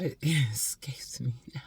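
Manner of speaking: whispered, laughing at herself. Real, not acted. Woman forgets her point, whispering through a laugh. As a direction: breathe it out through a giggle. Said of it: "oh my God".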